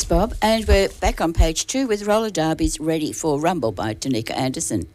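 ordinary talk

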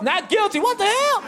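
very high, mock-stunned cry; incredulous outcry. Courtroom reacting to an absurd verdict, high incredulous cry. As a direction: squeal in comic disbelief.

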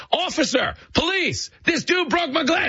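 mock yelling. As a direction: fake alarm to scare the tourists. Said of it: "the guy just starts yelling".

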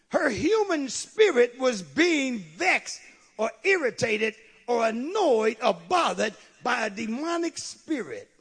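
high, ringing pulpit delivery; forceful preaching. Acted. Preacher telling of the demon-vexed girl, forcefully. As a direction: press every word on the congregation.

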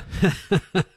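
real chuckle breaking into the sentence; amused laugh at the fans' expense. Real, not acted. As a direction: burst out in a short, helpless laugh. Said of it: "I'm still laughing".

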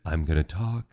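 slow, soft, hushed radio critic voice, laughing through it; NPR food critic. Retold or imitated. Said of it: "if you speak softly and slowly".

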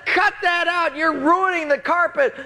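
high-pitched, outraged scolding; hammed-up roommate outrage; indignant yelling. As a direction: retell an angry protest at full pitch. Retold quarrel over flooded room.

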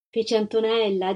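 plain reading voice

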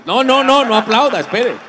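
loud, high objection; urgent protest. Real, not acted. Speaker stopping his audience from clapping, loud protest. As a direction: cut the crowd off forcefully.

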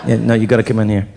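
low, confidential producer voice; amused producer. Acted. Producer calling colleagues in to watch, low and incredulous.

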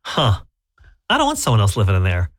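ordinary talk

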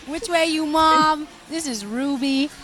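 hammed-up American country voice; country twang. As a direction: stretch it out like a country singer. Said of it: "give us your best country voice".

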